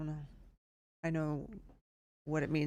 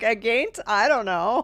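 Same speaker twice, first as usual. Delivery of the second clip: squeaky laughing mock reading; giggling through the words; 6 semitones higher, 11 dB louder than usual. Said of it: "I'm like".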